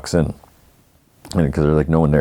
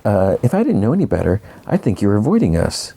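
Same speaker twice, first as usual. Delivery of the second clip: put-on high, swooping mean-girl voice; 5 semitones higher than usual. Patty to Marge, who is avoiding her, mean girl. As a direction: play the mean girl with lilting, raised pitch. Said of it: "mean girl number one".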